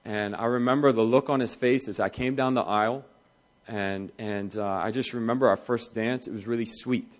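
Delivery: soft, quiet, tender recollection; fondly remember the first dance, voice softening; wife lovingly recalling her wedding day, gently; fond memory. Retold or imitated.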